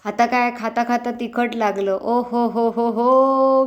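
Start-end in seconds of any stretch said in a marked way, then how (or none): 2.5-3.7 hot chili gasp. Pretend.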